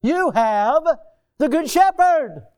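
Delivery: a preacher swells into a loud proclamation to the church; emphatic proclamation. Acted. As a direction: preach it out with full force. Repeated louder for emphasis.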